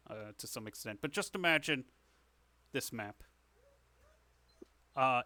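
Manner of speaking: ordinary talk, nothing marked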